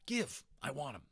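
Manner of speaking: sudden high, loud cry of want; snatch at it, can't wait; eager grab. A narrator's reading. Patrick begging Omri to hand over the little man, eager and loud. Eager boy grabbing for the toy.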